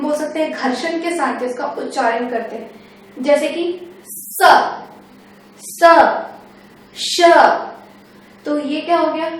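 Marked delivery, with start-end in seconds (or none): 3.2-7.2 स and श drawn out slowly with a steady hiss of air; hissing friction sounds. Shown as a demonstration. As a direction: let the air rub through your teeth on स, स, श. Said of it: "इसके उच्चारण में हम घर्षण का".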